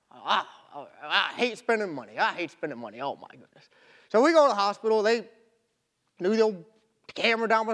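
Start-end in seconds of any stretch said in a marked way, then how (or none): 0.1-3.7 low, softening grumble that trails away; balk, then grumble down into your chest; reluctant grumbling. Retold or imitated. Husband balking at wife's hospital suggestion, reluctant grumble.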